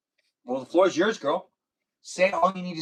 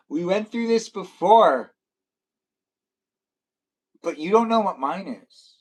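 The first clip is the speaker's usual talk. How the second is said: lightly mimicked complaint, higher and louder; imitating a viewer; 5 semitones higher, 6 dB louder than usual. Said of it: "she's like".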